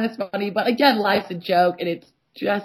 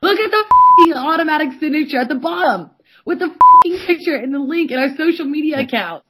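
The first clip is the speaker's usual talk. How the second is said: higher and louder, spitting it out; all caps rant; 6 semitones higher, 5 dB louder than usual. Retold or imitated. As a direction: shout it like a furious all-caps text. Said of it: "All caps".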